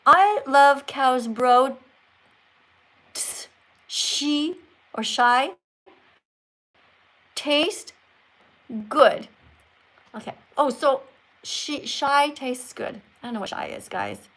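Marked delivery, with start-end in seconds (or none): 0.0-9.3 very slow, halting read-out; word-by-word reading. Real, not acted. Teacher deciphering a viewer's garbled sentence, slow and deliberate. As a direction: sound out each word with long gaps. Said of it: "here's his actual sentence".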